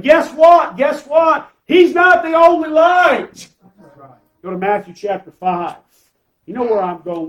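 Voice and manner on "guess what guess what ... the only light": rising to a loud, high preaching cry; fervent pulpit shout. Real, not acted. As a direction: lift the room, louder with each phrase. Preacher building to a climax.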